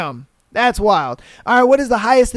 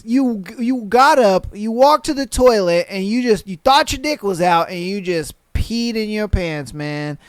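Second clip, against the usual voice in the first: normal talking voice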